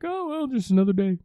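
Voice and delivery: high, breezy, shrugging it off; blasé Sonic. Retold or imitated. Sonic to the freaked-out guy, high and unbothered. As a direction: toss it away like it's routine. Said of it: "Sonic's just like".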